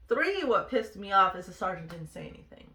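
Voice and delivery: normal talking voice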